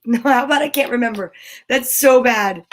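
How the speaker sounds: plain reading voice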